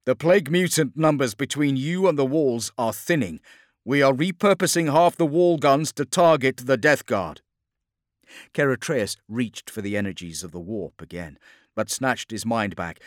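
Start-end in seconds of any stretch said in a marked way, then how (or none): 0.0-7.4 loud, high military report; projected, urgent radio voice; sergeant over vox. Acted. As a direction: call the tactical update over battle noise. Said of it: "came Sergeant Marcus's voice over the vox".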